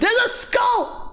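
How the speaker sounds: high, slow excited shout. Real, not acted. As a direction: gasp and shout it out. Spotting a skull piece.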